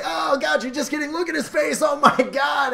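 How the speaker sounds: very high, excitable shouted patter; Charlie Day. Retold or imitated. Rafting guide teasing a guest after his joke, high excited voice. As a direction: crow over your own joke in a high voice. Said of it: "in his, like, high Charlie Day voice".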